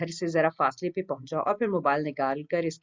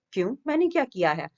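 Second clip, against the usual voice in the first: a nonchalant, higher mock-innocent ask; carefree innocence; 6 semitones higher than usual. A narrator's reading. Waleed brushing off Izzat's complaint, carefree. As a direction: play innocent, breezy and unbothered. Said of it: "انداز میں لاپرواہی رچی ہوئی تھی".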